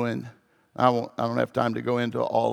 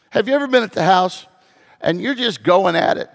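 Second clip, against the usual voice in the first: ordinary talk, nothing marked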